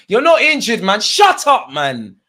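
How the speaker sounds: loud, high-pitched scolding of a player; exasperated shout. Real, not acted.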